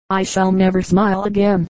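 ordinary delivery

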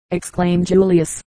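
ordinary delivery

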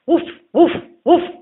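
high, loud yapping woof; yelping dog barks. A narrator's reading. Dog yelping to warn the sick old man. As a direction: bark sharply like a little dog. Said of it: "the dog yelped".

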